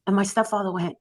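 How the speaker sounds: ordinary talk, nothing marked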